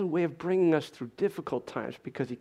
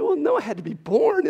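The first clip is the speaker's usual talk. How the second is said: emphatic retort; 11 semitones higher, 8 dB louder than usual. Retold or imitated. Arguing back with grandma.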